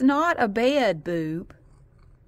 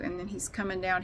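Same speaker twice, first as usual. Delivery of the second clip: ordinary delivery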